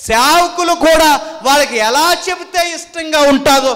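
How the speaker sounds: high, loud, driving sermon; indignant preaching. Real, not acted. Preacher decrying entertainment in church to congregation, indignant forceful preaching. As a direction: rail against it from the pulpit. Rails against mimicry in church.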